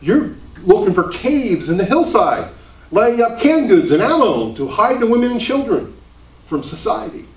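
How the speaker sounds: high, loud, scoffing exclamation, then teasing; mocking fellow pastors. Pastors ribbing the Baptist about hiding from society, scoffing. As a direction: lampoon the other ministers' scorn for Baptists. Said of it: "And they're going".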